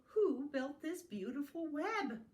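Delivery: lifted, lilting owl voice; storybook owl. Acted. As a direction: ask with wonder as the owl. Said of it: "asked the owl".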